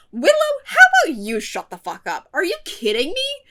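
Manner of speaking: loud, high outburst at Willow; pissed off. Real, not acted. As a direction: snap back indignantly, voice jumping up. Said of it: "makes me so pissed".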